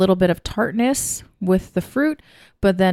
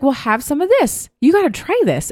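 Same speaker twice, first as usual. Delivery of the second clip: much higher, eager urging; lightly voiced friend, pitched well up; imitating Sharon's offer; 8 semitones higher than usual. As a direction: push a drink on a friend in a lifted voice. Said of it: "She was like".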